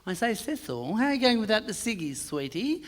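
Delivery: a light, soft camp lilt; lightly voiced camp gentleman; two men greeting in the street, camp. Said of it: "two Hobart homosexuals".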